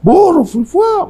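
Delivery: very high, slow calling with whip sounds; spirit driving a donkey. Retold or imitated. Imitating the spirit rider whipping the donkey, very high eerie call. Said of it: "se oía los chuchazos que le daban al burro".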